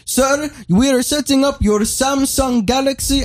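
high, mock Indian accent; hammed-up Indian customer service voice; imitating Indian call agent. Caller mimics the polite agent, in a mock Indian accent. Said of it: "He was like".

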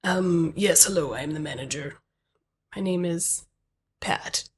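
a hint of a reserved manager introducing themselves; manager character. Plays the manager Pat.

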